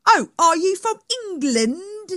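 high, loud imitation of an eager American; mock American accent. British guest imitating Americans he meets, exaggerated American accent. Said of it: "You'll be talking to someone and they go".

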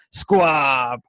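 loud sudden yell of "Squab!"; startling shout. Retold or imitated. Jake sneaks up behind grandma, sudden loud shout. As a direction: jump out and shout it to scare her. Boy jumps out to startle her.

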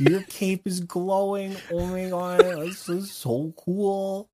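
high, slow put-on voice of awe; fawning beggar. Beggar admiring a player's glowing cape, high exaggerated fawning. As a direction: gush in a high drawn-out beggar voice. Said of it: "That's begging".

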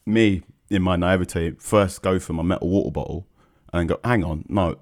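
ordinary delivery

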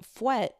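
fouet said slowly as fwet; Patois word. Shown as a demonstration. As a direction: say fouet as fwet, one syllable. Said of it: "teach y'all a word in Patois".

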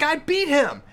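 put-on high indignant boy voice; indignant kid. Son protests the ratings, high indignant kid voice. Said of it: "He's like".

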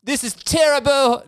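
high, hammed-up British exclamation; British movie impression. Host mimicking Forgetting Sarah Marshall, high British outburst. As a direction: exclaim it in a badly faked British accent. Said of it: "that's my forgetting Sarah Marshall".

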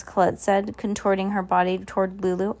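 ordinary delivery, nothing marked

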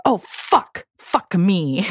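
mock exasperated swearing impression; Grace. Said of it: "Mine is Grace, and it's just".

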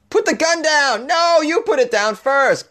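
very high yelled standoff; hammed-up shouting match; mock standoff shout. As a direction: play a cop and crook yelling.

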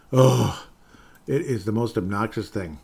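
ordinary talk

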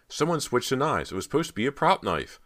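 high, loud frantic protest; crying out. A narrator's reading. Cody explains the switched knives, high frantic cry. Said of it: "Cody cried".

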